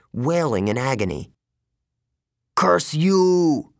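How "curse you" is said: high, loud curse; cried out. Acted. Wounded manticore cursing the hidden archer, loud and high. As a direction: spit the curse out in pain and rage. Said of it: "Thorne cried".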